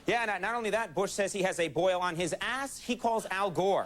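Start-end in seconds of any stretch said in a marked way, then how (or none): none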